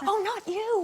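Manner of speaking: high-pitched, apologetic realisation; startled correction. Retold or imitated. Film star correcting the guest across the room, startled. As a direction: pitch it up as the mistake dawns. Craig's awkward correction, acted out.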